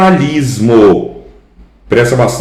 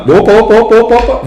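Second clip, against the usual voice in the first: quick, high startled opa; 11 semitones higher, much faster than usual. Real, not acted. Teacher catching a counting mistake, startled. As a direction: stop yourself short. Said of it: "catches a counting slip".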